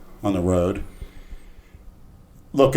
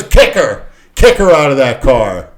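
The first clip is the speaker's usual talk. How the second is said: loud imitated command, pitched up; shouted cop order; 3 semitones higher, 9 dB louder than usual. Cop ordering the driver to eject her, loud command. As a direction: bark it out as the cop.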